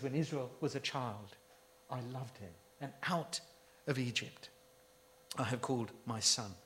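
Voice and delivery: preacher hushing God's words to a near murmur; hushed tender quote. Retold or imitated. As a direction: drop right down and say it gently. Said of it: "He says".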